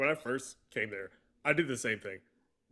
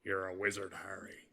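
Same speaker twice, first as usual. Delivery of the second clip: Hagrid; 3 semitones lower, 5 dB quieter than usual. Acted. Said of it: "so Hagrid from Harry Potter".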